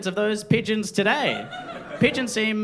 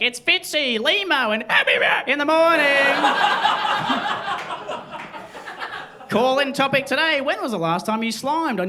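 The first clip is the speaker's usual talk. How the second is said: upbeat, higher breakfast radio host intro; 6 semitones higher than usual. Pretend. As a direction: announce it like perky morning radio hosts.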